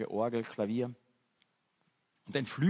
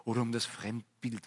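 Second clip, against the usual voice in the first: usual speaking voice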